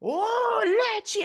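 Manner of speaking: high, loud whoop of recognition; drawn-out, high-pitched mock holler; imitated delighted greeting. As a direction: cry out the name like spotting an old friend, hammed up. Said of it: "He goes".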